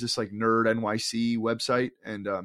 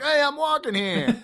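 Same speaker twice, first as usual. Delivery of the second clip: imitating a woman's New York yell, much higher and slowed; New York accent; 3 dB louder, slower than usual. Retelling a woman on the sidewalk, a high mock New York yell. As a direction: squawk it like an offended New Yorker on the sidewalk. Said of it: "I actually did hear some woman say that".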